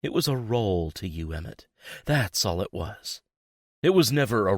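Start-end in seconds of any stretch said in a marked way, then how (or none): none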